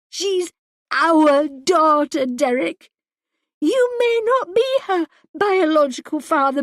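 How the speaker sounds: high, loud, and relentless; furious tirade. A narrator's reading. Wife rages at husband over missing daughter, furious. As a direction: demand he feel something.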